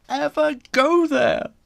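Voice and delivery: plain reading voice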